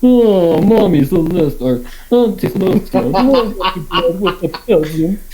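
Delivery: lightly voiced whiny rich colt.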